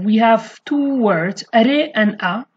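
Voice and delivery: ordinary talk, nothing marked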